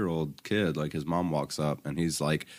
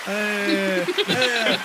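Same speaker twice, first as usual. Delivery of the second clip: squealed hey, hey in falsetto; loud high greeting; 7 dB louder than usual. Real, not acted. Host greeting a visitor with a loud high hey. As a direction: call out a delighted high hello.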